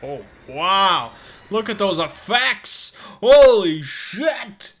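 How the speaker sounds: loud, high, slow amazed outburst; awed exclamation. Real, not acted. Player stunned by the game's effects, loud awed exclamation. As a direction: marvel out loud at the visuals.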